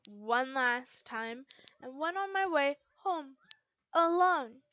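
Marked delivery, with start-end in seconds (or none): none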